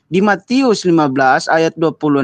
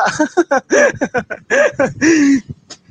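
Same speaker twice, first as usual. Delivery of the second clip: high teasing giggle at the opponent; mocking laugh; 9 semitones higher than usual. Real, not acted. Debater laughing at Pak Lamsen's claim, high mocking giggle. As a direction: laugh off his argument, high and light.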